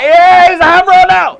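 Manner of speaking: high, loud, slow mocking jeer; taunting player. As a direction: jeer at the away fan, hammed up. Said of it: "he's like".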